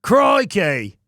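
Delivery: hammed-up Aussie exclamation; mock Australian. Player poking fun at his botched voice, mock Aussie. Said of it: "fucked that voice up".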